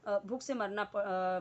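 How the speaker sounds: ordinary talk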